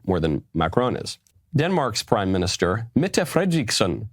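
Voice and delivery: ordinary talk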